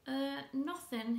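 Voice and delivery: put-on a boy fumbling a denial to his mother, stammering; stammered. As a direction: trip over the words, caught out. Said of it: "he stammered".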